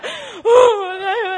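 loud, high wail imitating crying; mock crying. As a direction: bawl in exaggerated imitation. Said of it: "crying like a 진짜 미친 crazy girl".

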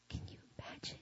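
breathless awed whisper; real moved whisper. Real, not acted. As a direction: barely breathe the words in wonder. Overcome by the memory.

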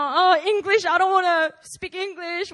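mock a complaining expat; mocking foreigner. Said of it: "all these foreigners, you know".

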